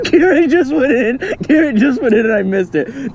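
high, loud, cracking up; laughing. Real, not acted. Telling that Garrett just went in, laughing. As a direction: squeal it out through laughter. Friend fell in, filmer cracks up.

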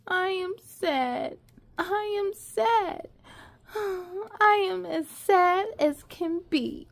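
slow, teary, mock-sad refrain; a storyteller's pretend sob; mock crying. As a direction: whimper it out like a sad child.

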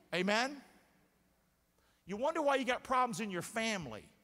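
normal talking voice

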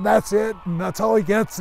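mock outraged kid sister yelling; sisters crying out. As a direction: wail it like an outraged little sister. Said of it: "my sisters were going".

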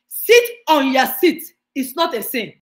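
loud, firm divine command; God commanding. Acted. Preacher voicing God telling him to sit, loud command. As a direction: boom it out as God speaking. God's voice quoted in sermon.

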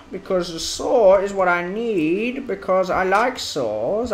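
ordinary delivery

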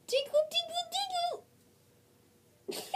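high, teasing tickle voice; squeaky sing-song tickling; giggly tickle attack. Acted. Tickling friend under cup.

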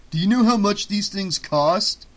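loud, high protest; indignant. Acted. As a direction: argue back in outrage. Eric protests angrily.

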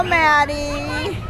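ordinary talk

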